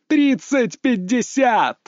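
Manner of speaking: slow, drawn-out score call, high. Acted. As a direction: stretch the score like an announcer. Commentator calls the score.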